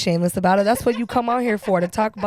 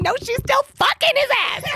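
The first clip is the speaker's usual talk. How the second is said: pitched way up in disbelief; high incredulous retort. Retold or imitated. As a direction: squeak it out, scandalised. Retorting loudly to absurd claim.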